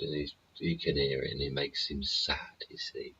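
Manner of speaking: whispered, barely voiced; hushed whisper. Real, not acted. Owner whispering to viewers about his sad rescue dog, hushed whisper. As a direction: drop to a whisper so the dog isn't disturbed. Talking beside the sleeping dog.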